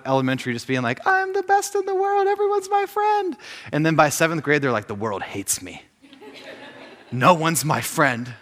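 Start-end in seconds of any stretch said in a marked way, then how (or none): 1.1-3.3 very high, squeaky kid impression; gleeful middle schooler. New middle schooler boasting after elementary school, mock squeaky glee. 4.9-8.3 mock dejection. Seventh grader feeling friendless, mock dejected voice.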